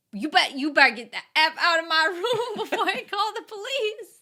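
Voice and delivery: loud, high, laughing tough aunt. Acted. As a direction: bark it out like a fierce aunt. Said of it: "I want an Aunt May that's like".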